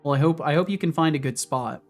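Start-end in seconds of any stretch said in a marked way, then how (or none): none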